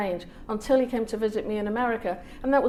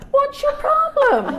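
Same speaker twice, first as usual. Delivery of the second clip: high, slow, laughing mock indignation; mock outrage; 10 dB louder, slower than usual. Author squealing at the St Patrick's crowd in high laughing outrage. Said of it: "I'm like".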